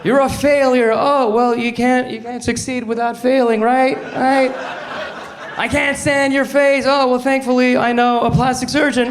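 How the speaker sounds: acted couple exchange. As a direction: play it bright and chirpy. Couple exchange, high acted voices.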